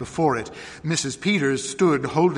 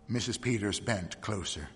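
ordinary delivery

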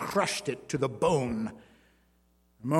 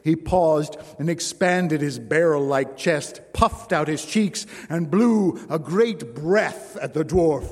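ordinary delivery

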